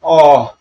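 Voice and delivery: loud groan on being struck; acted cry of pain. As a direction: cry out as the blow lands. Villain cries out when struck. (दर्द भरी ज़ोरदार आह)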